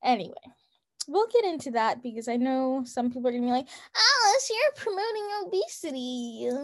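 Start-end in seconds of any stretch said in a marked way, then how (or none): 3.9-6.5 high-pitched mocking imitation of a critic. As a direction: put on a much higher voice to parody objectors. Mimics critics who will object.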